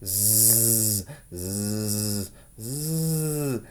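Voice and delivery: quiet, low snores through the nose; girl snoring away in bed; soft snoring. Acted. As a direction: snore softly in deep sleep. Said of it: "Amy snored".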